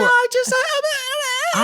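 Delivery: flustered girl imitation. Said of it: "And she's like".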